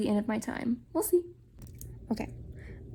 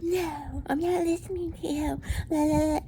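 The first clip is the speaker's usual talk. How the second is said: mocking Edward; 5 semitones higher than usual. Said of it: "he's like".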